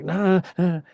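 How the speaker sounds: a hint of an anxious girlfriend pleading no; girlfriend panicking. Girlfriend stops him from crossing near the celebrity, mildly panicked. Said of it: "My girlfriend held me back, like".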